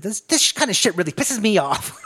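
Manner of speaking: mock the frustrated old villain's complaint; David Lopan. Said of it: "just fucking bitter".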